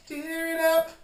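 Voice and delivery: loud excited urging. Shown as a demonstration. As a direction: push them on with a joyful burst. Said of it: "Get in there".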